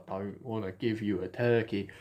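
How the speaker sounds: low, soft grumpy miser; Michael Caine. Acted. Scrooge refusing a turkey, low grumble. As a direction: drop it low and quiet like Scrooge. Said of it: "Michael Caine's like Scrooge".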